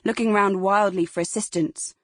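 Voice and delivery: plain reading voice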